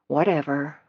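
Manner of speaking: soft dismissive mumble; mumbled. Acted. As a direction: mutter it, brushing him off. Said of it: "I mumbled".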